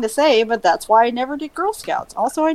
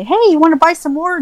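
quick, higher, louder mimicked sales pitch; mock-eager, rushed and raised; imitated pushy sister; 6 semitones higher, 6 dB louder, faster than usual. Imitates sister constantly selling cookies.

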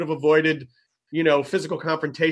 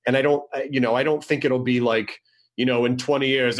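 usual speaking voice

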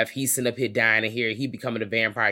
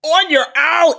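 much higher, louder swooning repeat; squealing swoon; 12 dB louder, slower than usual. Retold or imitated. Host swooning over Blacula's line, high and loud. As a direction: squeal the line back like a fan melting. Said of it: "You will come to me on your own".

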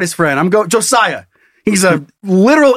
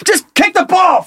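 mock exasperated shout; 11 semitones higher than usual. Exasperated player.

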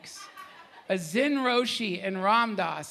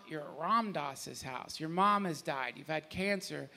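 ordinary delivery

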